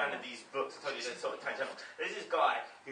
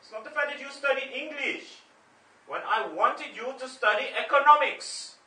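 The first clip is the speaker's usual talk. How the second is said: put-on dad's Indian accent; 11 semitones higher, 8 dB louder than usual. Father scolding his son over his bad accent, in Indian accent. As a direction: lecture your son in a heavy Indian accent. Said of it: "you do my accent so badly".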